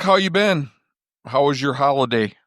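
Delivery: ordinary talk, nothing marked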